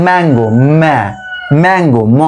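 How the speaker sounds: ম্যাঙ্গো broken into ম্যা and ম, stressing the m onset; sounding out mango. Shown as a demonstration. Teacher sounds out ম্যাঙ্গো to show the m start. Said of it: "দেখো প্রথমেই দেখো".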